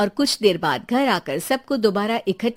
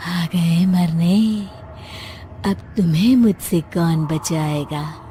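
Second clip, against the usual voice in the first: slow, low menacing ghost voice with breathy cackles; ghost witch cackle; 3 semitones lower, slower than usual. Acted. Ghost bride threatening the intruders, menacing cackle. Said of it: "भूतनी की आवाज".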